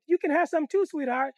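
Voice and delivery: high, sugary coaxing stranger voice; sweet candy lady. Retold or imitated. Stranger in a car luring kids, high sugary coax.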